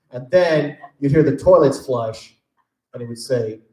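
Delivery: ordinary delivery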